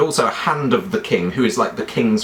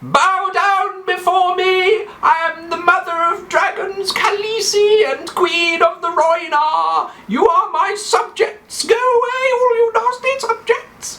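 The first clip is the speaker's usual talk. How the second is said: very high, drawn-out falsetto proclamation; hammed-up squealing dragon queen; Daenerys Targaryen; slower than usual. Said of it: "she goes like this".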